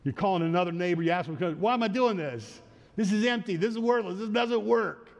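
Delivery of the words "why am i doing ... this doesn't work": higher-pitched frustrated questioning; acted exasperation, insistent and raised; exasperated complaint. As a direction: voice a fed-up volunteer, pitched up. Voicing a discouraged volunteer's complaint.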